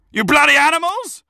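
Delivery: high-pitched yell from the touchline; heckling shout. Acted. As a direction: shout it like an irate dad at a match. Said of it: "he shouted".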